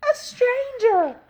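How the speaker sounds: very high, drawn-out loud squeal; Little Honey. A narrator's reading. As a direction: squeal in alarm like a tiny bear. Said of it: "squealed Little Honey".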